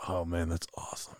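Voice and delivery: low, hushed awe; a lightly hushed, awed buddy; awed listener. Retold or imitated. Second guy in awe of the deer story, hushed. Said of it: "I'm gonna do both parts".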